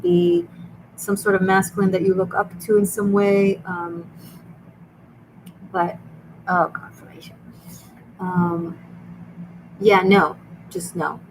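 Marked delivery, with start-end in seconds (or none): none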